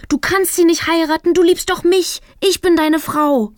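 high, loud desperate cry; jilted woman shouting at the altar; shouting desperately. A narrator's reading. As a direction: yell it to stop the wedding. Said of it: "rufe ich".

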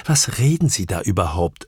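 plain reading voice

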